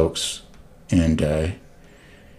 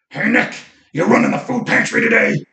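much higher rough mock greeting; imitating Mark's rough voice; 3 dB louder than usual. Client Mark bursting into Nick's office, rough imitation. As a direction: shout it like the usual morning ritual. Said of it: "he had this rough voice".

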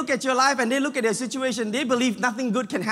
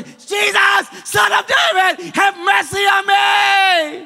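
a blind beggar yelling for help; desperate shout; 10 semitones higher, 9 dB louder, much slower than usual. Acted. Blind Bartimaeus shouting to Jesus from the roadside, desperate loud cry. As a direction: cry it out at the top of your lungs, slow and stretched. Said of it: "he kept shouting louder and louder".

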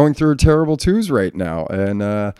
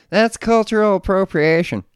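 high whiny mock of an offended critic; high mocking scold; 10 semitones higher than usual.

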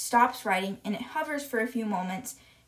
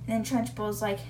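usual speaking voice

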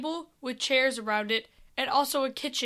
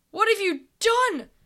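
frightened; 8 semitones higher, 4 dB louder than usual. Acted. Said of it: "petrified with fear".

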